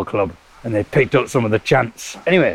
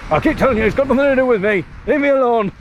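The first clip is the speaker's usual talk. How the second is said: pitched way up, fast, pleading to be left alone; high mock protest; 11 semitones higher, faster than usual. Dad yelling back at the child during podcast, high mock protest. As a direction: squeal like a harassed dad.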